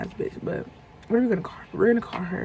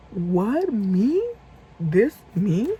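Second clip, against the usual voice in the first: incredulous; 4 dB louder, much slower than usual. Retold or imitated. Incredulous at being accused.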